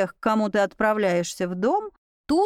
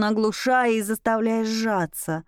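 usual speaking voice

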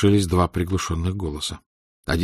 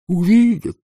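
mocking; 10 semitones higher, 4 dB louder than usual. Boy mocks Tanya angrily.

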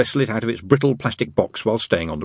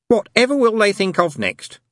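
musing; 12 semitones higher than usual. A narrator's reading. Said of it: "mused Ligor".

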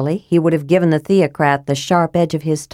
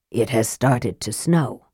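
lightly voiced, lower, slow and soft; a hint of the sinister mage; Raistlin reporting; 6 semitones lower, 5 dB quieter than usual. A narrator's reading. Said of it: "he reported".

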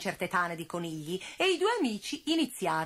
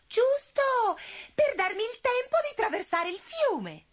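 put-on high sly cat girl voice; 8 semitones higher than usual. A narrator's reading. Sutica persuades her brother.

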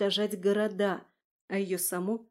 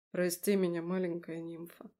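lower, much softer tender apology; 3 semitones lower, 4 dB quieter than usual. Acted. The husband apologizes tenderly to his crying wife. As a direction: soothe her gently.

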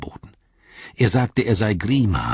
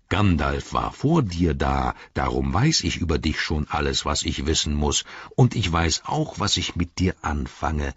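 Treebeard; 4 semitones lower than usual. Acted. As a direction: let the words rumble out low and unhurried. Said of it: "Dann sagte Baumbart".

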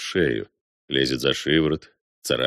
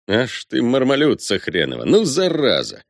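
angry cursing; 7 semitones higher than usual. Acted. Cursing someone grabbed in a struggle.

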